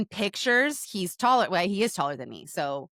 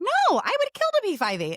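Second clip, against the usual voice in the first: high, lightly mimicked mother; mom's voice; 13 semitones higher than usual. Mom protesting to her daughter, a high mock-mother voice. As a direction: play mom protesting with envy. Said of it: "And she was like".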